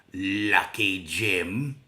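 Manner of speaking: very slow, loud, suspicious Australian drawl; angry Australian accent. Retold or imitated. A mum sneering at her daughter's friend in a slow Australian accent. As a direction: stretch it out like a contemptuous Australian mum. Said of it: "thick, very angry, uh, Australian accent".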